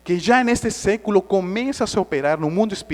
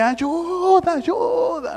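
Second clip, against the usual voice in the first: high, drawn-out retold shout; hammed-up high-pitched yell for help; mock cry for help; 13 semitones higher, slower than usual. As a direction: call out as if drowning. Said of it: "Aí eu gritei".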